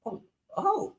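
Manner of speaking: very high startled squeak; caught off guard. Retold or imitated. Diner thinks he offended the waiter, squeaking in surprise. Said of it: "And I was just like".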